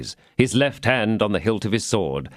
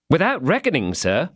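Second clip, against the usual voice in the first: lightly voiced servant Planchet, raised a little; a hint of an excited young servant; eager servant; 7 semitones higher than usual. A narrator's reading. Servant eagerly reminding his master, lightly voiced.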